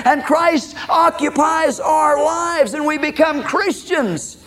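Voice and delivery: high, ringing preacher's build, slowing at the end; high-pitched sermon crescendo; impassioned preaching. Real, not acted. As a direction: preach it high, drawing out the final phrases.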